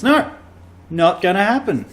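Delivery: loud, high refusal; emphatic. Real, not acted. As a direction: refuse firmly.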